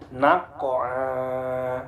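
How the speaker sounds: NAQA'A as 'naq-qo-aa', Q released with a bounce, long ending; bouncing qalqalah. Shown as a demonstration. Teacher shows qaf example, bouncing recitation. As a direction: bounce the stopped Q, then hold the last vowel. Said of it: "Qafnya mati di tengah".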